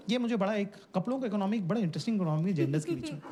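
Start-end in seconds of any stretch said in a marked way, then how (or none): none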